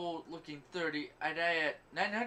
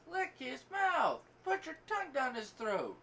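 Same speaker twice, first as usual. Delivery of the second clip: mock yelled command; 9 semitones higher than usual. Acted shouts in retold scene.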